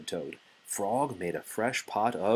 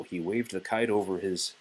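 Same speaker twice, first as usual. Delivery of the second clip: plain reading voice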